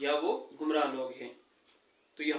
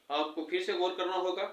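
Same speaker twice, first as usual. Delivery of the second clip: ordinary talk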